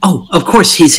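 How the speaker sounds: ordinary delivery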